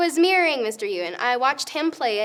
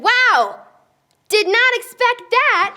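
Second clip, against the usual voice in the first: loud high surprised exclaim; 10 semitones higher, 9 dB louder, much slower than usual. Retold or imitated. Girl retelling callback news, loud and surprised. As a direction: blurt it out, amazed.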